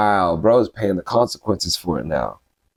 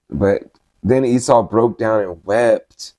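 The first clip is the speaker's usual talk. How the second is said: ordinary talk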